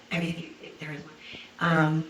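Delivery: ordinary delivery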